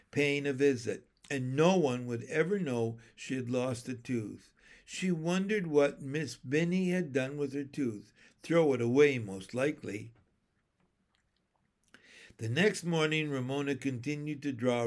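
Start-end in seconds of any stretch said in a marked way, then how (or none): none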